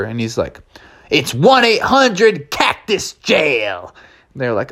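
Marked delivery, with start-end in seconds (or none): 1.1-3.9 slow, high-pitched southwestern drawl; drawn-out southwestern cowboy voice. Acted. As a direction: boast like a southwestern sheriff. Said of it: "talking with a nice southwestern drawl".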